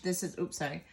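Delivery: ordinary delivery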